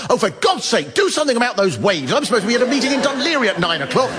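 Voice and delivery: high, exasperated complaint, rushed at the end; impatient passenger. Retold or imitated. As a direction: huff at the captain like a spoilt passenger. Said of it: "going up to the captain going".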